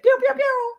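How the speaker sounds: squeaky imitated laser-gun shots; playful high-pitched pew sounds; mock gunfire, squealed; high pew-pew gun noises. Mimics imagined gun noises.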